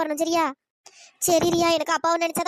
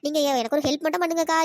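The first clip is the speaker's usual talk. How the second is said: usual speaking voice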